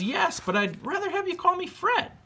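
very high, sing-song ghost voice; friendly ghost. Acted. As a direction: float it up high like a goofy ghost. Ghost answers George.

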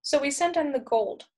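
ordinary delivery

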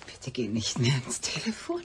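hushed, urgent whisper; frightened whispered plea. Acted. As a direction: beg him in a whisper not to answer. Pleading lover, afraid for him.

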